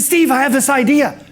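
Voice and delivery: high, eager cheery voice; John Spanath. Retold or imitated. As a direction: burst in brightly with an idea. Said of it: "he's all cheery".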